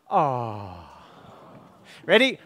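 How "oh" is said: low soft falling oh; a soft doting oh; aww for the baby. Shown as a demonstration. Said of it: "you say".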